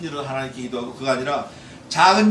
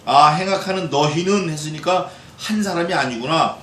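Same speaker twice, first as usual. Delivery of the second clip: usual speaking voice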